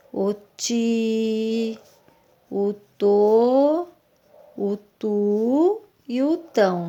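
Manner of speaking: "ti", "to", "tu", "tão" each said slowly and clearly on its own; syllable sounds. Shown as a demonstration. Teacher sounding out "ti", "to", "tu", "tão" for pupils, crisp and separate. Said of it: "parecida com o T".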